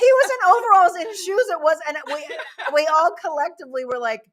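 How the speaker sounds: high, loud protest through laughter; laughing as she defends herself. Real, not acted. Host defends her choice to her cohosts, laughing.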